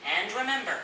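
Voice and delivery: playful pirate lilt; pirate accent. Pretend. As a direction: talk like a jolly storybook pirate. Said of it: "a pirate accent".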